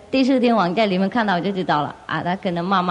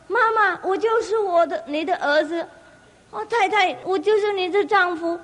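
high, pleading voice to mother and wife; pleading elder; 9 semitones higher than usual. Acted. Elder begs his mother and wife to know him, high plea. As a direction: beg them to recognise you, pitched up.

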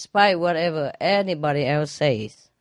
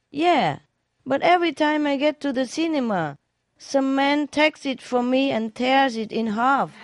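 put-on drunk's reply; 8 semitones higher than usual. Joke, drunk replies.

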